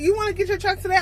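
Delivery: a light imitation of an eager recruiter; recruiter asking.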